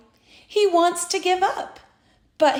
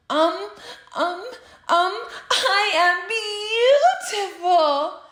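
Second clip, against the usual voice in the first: shy giggle; 5 semitones higher than usual. A narrator's reading. Frog admires his reflection.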